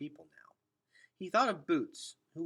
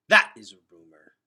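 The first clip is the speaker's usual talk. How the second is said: loud, scornful brush-off; dismissive; 5 semitones lower, 21 dB louder than usual. Acted. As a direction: wave it away with scorn. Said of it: "said Henry dismissively".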